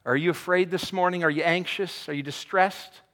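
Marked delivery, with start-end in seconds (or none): none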